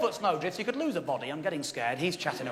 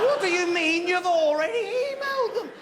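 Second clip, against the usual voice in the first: panicked disbelief; 6 semitones higher than usual. Retold or imitated. Shocked guest to Warren, high panicked question. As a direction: fling the question out in alarm.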